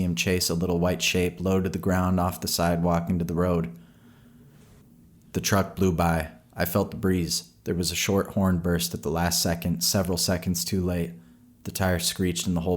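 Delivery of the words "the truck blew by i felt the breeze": plain reading voice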